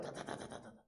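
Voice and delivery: voiceless, soft rattle of chattering teeth; shivering chatter. Retold or imitated. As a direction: imitate uncontrollable shivering. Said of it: "막 떨리잖아요". (덜덜 떨며 이빨 부딪치는 소리 흉내)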